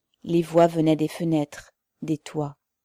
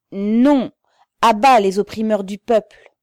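loud, raised rallying cry; defiant shout; 4 semitones higher, 10 dB louder than usual. A narrator's reading. Protester refuses the officer the flag, defiant shout. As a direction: refuse at full voice, then rally.

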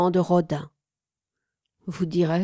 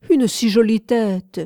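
exclaiming louder; cry out; 4 semitones higher, 7 dB louder than usual. A narrator's reading. The minister cries out, a little louder. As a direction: call out in dismay at the broken statue. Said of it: "s'écria Dujardin-Baumet".

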